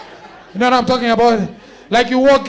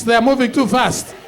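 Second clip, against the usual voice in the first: ordinary delivery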